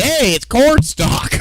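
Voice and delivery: high, slow, mocking sing-song. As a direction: squeak it out high and slow like a know-it-all. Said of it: "and I'm saying".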